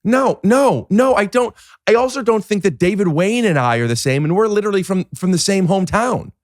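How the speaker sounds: high-pitched, insistent protest; mock indignation. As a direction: protest in hammed-up outrage. Keeps up the acted indignant protest.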